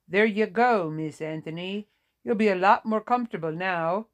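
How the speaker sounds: lightly voiced nurse with a Vietnamese accent. A narrator's reading. Nurse settling an old patient, Vietnamese accent. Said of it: "said in a thick Vietnamese accent".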